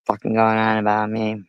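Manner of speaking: usual speaking voice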